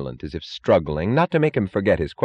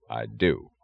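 low, cold, quiet answer; cold trader's voice; 7 semitones lower, 4 dB quieter than usual. A narrator's reading. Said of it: "a cold trader's voice answering".

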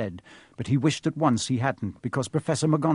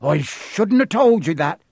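blurted out louder and higher; 5 semitones higher, 6 dB louder than usual. Acted. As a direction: let it slip out in a rush. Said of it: "he blurted out".

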